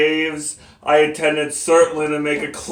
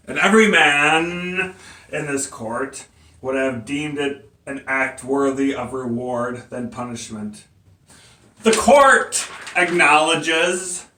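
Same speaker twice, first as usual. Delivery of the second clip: ordinary talk, nothing marked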